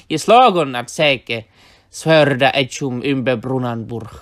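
put-on loud, measured reading of Old English verse, each stress hit hard; Old English recital. Shown as a demonstration. Teacher reciting the Old English poem to viewers, loud and rhythmic. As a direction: declaim the Old English lines like a bard. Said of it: "And in Old English, it went".

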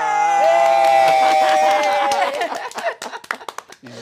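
loud, high, long burst of laughter; whooping laughter. Real, not acted. Host and guests reunited on air, uproarious laughter. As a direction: erupt into cheering laughs. Everyone erupts at the greeting.

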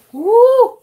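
loud high whoop; cheering whoop. Real, not acted. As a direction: whoop with delight. Cheer on opening gift.

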